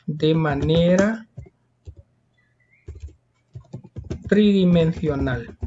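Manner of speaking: slow, spaced-out dictation; drawn-out words, time left for writing; dictation pace. Shown as a demonstration. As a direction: dictate it phrase by phrase with long pauses. Same words repeated slowly for dictation.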